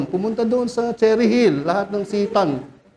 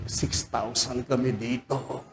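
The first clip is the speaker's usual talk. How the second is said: sly demon; 8 semitones lower, 11 dB quieter, slower than usual. Acted. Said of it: "Ibig sabihin".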